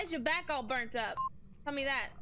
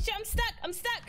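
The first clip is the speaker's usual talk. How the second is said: panicked; 6 semitones higher than usual. Real, not acted. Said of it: "I got stuck on a fucking wall".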